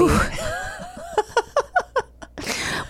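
squealing laugh, high. Real, not acted. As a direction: let out a high giggle fit. Laughing fit.

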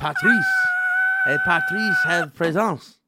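hammed-up fake French accent, slow; mock French announcement. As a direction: say it like a pompous Frenchman. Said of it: "Patrice is actually French for presence".

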